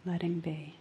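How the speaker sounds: slow, soft meditation voice, partly whispered; guided meditation. Acted. Teacher settling the listeners into meditation, soft and slow. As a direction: ease listeners into quiet noticing.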